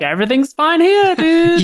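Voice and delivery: high-pitched impostor playing it casual; fake security guard. Fake guard reassuring the cop, hammed-up voice. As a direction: imitate a badly disguised guard, pitched high. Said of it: "the fake security guard's like".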